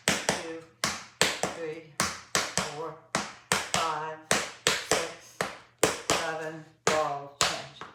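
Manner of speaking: loud rhythmic counting; counting out steps. Shown as a demonstration. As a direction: call the counts like a tap teacher. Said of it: "get ready to flap".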